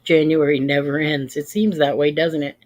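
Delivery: ordinary talk, nothing marked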